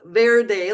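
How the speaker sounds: "verde" with an English V, not a B; English V sound. Shown as a demonstration. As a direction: buzz the lip on the V of "verde". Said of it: "like in English".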